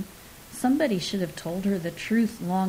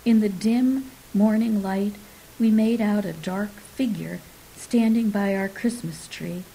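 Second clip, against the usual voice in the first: plain reading voice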